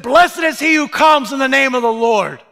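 high, loud proclamation; exultant acclamation; proclaiming. Retold or imitated. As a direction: announce it like the cheering crowd. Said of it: "they said".

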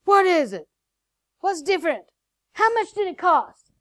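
loud, high, insistent questions; acted-out urgent interrogation; urgent questioning husband. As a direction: imitate a husband demanding answers. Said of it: "he grabbed my shoulders and he turned me around and he goes".

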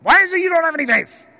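high, loud, fast scolding; harsh rebuke. Acted. Jesus scolding his frightened disciples, harshly. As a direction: snap it out as an angry reproach. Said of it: "Maybe Jesus said it harshly".

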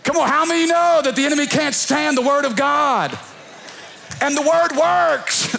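high, rousing preacher's call; full-force preaching. Real, not acted. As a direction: drive it home to the congregation.

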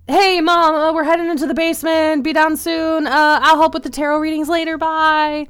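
calling out to another room. Acted. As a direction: call loudly, high, to someone off in the next room. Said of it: "Savvy kind of calls, uh, into the other room, be like".

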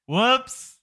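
playful whoops. Acted. Picard nearly falls out lift.

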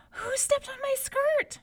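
high, loud, frightened princess; frightened cry. A narrator's reading. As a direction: cry out in alarm at the unseen touch. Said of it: "she cried".

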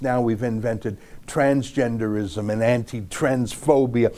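normal talking voice

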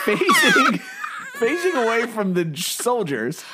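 words breaking up with laughter; cracking up mid-sentence; laughing. Real, not acted. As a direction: laugh through the words while describing. Laughing at the scene.

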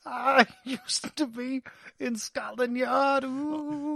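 slow, high British ghost voice with a laugh; British accent. Pretend. Ghost baby claiming Scotland Yard, in a hammy British accent. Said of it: "it's my British accent".

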